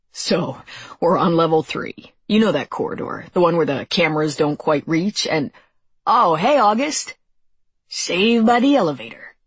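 lightly voiced chatty guy, lifting into a greeting; casual chatter rising to a bright hello; cheerful young soldier. A narrator's reading.

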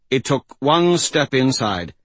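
plain reading voice

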